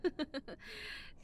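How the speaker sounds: breathy little giggle; a teasing giggle up close; soft giggle. Acted. Playful giggle in roleplay.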